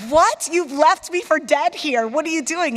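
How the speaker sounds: high, loud, mock outraged "what?"; incredulous disbelief. To her boss who just walked off, high indignant disbelief. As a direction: play up the shock of being left there. Retold shock at boss leaving.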